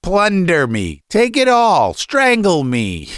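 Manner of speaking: high, slow, anguished outburst; unnatural shout. A narrator's reading. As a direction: wail it as a father being robbed. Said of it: "he shouted in an unnatural voice".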